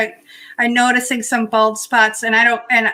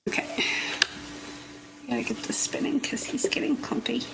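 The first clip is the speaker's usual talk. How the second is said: whispered to herself; whispering; 11 dB quieter than usual. Real, not acted. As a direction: whisper it while working. Whispered to herself while working.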